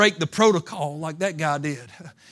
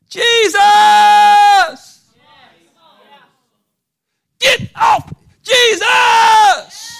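very high, loud scream; desperate shout; 14 dB louder, much slower than usual. Acted. Blind beggar screaming for Jesus over the crowd.

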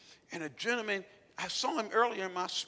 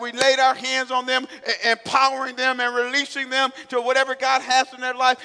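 preaching at full force; 5 semitones higher, 14 dB louder than usual. Real, not acted. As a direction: thunder it at the congregation.

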